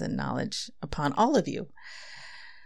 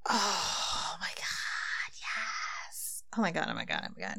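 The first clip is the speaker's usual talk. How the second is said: real breathless whisper of awe, fading away; awed whisper; 8 dB quieter than usual. Real, not acted. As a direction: whisper it, overwhelmed by the poem. Overwhelmed after reading the poem.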